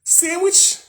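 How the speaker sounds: high, loud rising sandwich; high sandwich call. Acted. Vlogger wondering aloud about lunch, high rising call.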